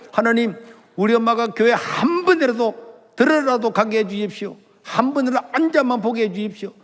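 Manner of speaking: high, urgent reenacted plea to God; desperate pleading prayer. Son carrying mother to church begs God, desperate pleading. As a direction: beg earnestly with pitch pushed up.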